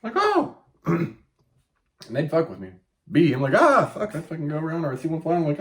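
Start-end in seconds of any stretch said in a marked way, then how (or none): 0.1-0.6 very high, loud squeal of "Oh!"; retold squeal. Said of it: "I'd fucking run and squeal". 3.5-4.1 startled curse. Retold or imitated. Scared of bee at work.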